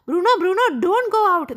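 shouted warning. A narrator's reading. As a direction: call out to stop the puppy. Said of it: "Sachin shout ചെയ്ത എന്താ?".

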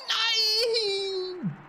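high despairing wail; anguished cry. Real, not acted. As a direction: cry out in loss. Game death.

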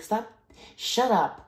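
imitated angry shut-up; mock snap. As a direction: bark it back at her. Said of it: "it's like".

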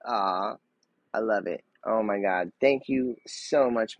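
ordinary talk, nothing marked

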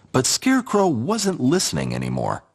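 plain reading voice

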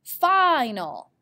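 "Final" with a held long I, then a short "nal"; stretched first syllable. Shown as a demonstration. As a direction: lean on the "fi" of "Final". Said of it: "The long I is at the end".

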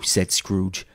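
ordinary delivery

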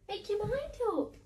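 high, mock-scolding pet talk; teasing the dog. Real, not acted. Owner teasing the dog over the door, high mock scold. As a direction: tease the dog playfully up high.

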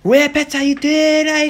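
high, mangled Geordie with hissy ending; bad Geordie accent. Shown as a demonstration. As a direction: squeeze out a botched Geordie catchphrase. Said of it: "Sound like a snake had eaten a Geordie".

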